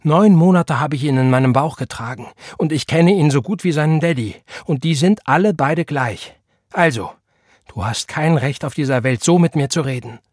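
flaring up. A narrator's reading. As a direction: stand up to him heatedly. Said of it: "brauste seine Mutter auf". (aufbrausend und empört)

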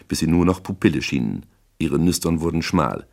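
ordinary delivery, nothing marked

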